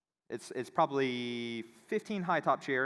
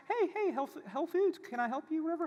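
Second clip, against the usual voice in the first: much higher, sing-song customer service voice; mock chirpy clerk. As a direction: parody a bubbly desk girl greeting. Mocking the service desk girl.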